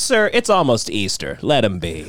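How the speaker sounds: lightly voiced calm churchgoer; a hint of a gentle preacher.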